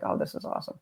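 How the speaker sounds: breathless whisper of amazement; whispered awe. Retold or imitated. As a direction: whisper it, quietly blown away. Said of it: "And I was like".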